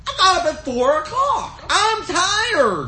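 acted-out protest; pleading husband. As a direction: plead with her, playing it up. Acted plea to his wife.

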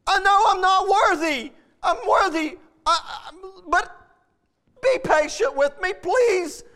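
high, imploring plea to his master; begging servant. Acted. Servant begging his lord for patience, high pleading. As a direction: beg for patience on your knees. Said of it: "You can see somebody begging".

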